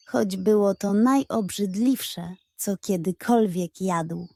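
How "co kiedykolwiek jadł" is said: plain reading voice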